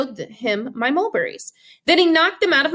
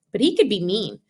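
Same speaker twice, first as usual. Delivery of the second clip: usual speaking voice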